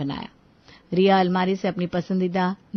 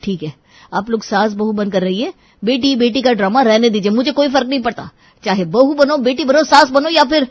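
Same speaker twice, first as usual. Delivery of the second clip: exasperated outburst, getting louder and higher; losing his temper; 4 semitones higher, 7 dB louder than usual. A narrator's reading. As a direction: blow up at both squabbling women. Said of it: "अब आर्यन का पारा चढ़ गया". (झुँझलाकर गुस्से में फटना)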